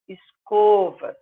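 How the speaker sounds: escovas slowly, the O kept closed. Shown as a demonstration. Teacher shows escovas keeps its closed O. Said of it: "Continua fechado".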